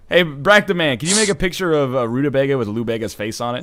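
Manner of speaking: ordinary talk, nothing marked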